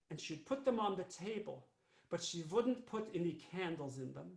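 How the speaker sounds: ordinary delivery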